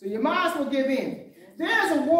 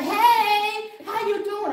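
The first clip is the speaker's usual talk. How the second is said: bright mock hello, pitched up; cheery greeting; 6 semitones higher, much slower than usual. Mimicking happy believers greeting everyone, cheery. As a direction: play a beaming churchgoer calling hello.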